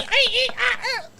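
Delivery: very high squealing eeks; mouse squeak. Pretend. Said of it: "I'd be".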